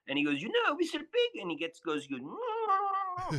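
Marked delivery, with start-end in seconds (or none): 0.4-1.4 imitating a squeaky Frenchman, very high; high-pitched French accent. Guest imitating stranger on mountain trail, shrill French accent. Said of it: "very high-pitched French accent". 2.2-3.4 put-on slow, very high French-accented wail; high-pitched French accent. Guest imitating stranger in his face, high French accent. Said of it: "very high-pitched French accent".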